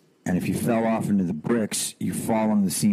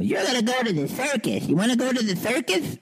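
higher lisping mom voice; sweet lisped mother; mom's voice. Retold or imitated. Mom telling little son about the circus, high lisped voice. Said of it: "my mom goes".

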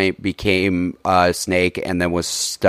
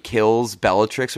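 ordinary delivery

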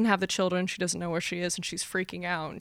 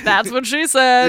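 loud, drawn-out punchline; goofy sitcom boss, half laughing; Michael Scott; 3 semitones higher, 7 dB louder, slower than usual. Retold or imitated. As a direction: crow the cheesy catchphrase slowly. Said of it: "I just picture Michael Scott from The Office".

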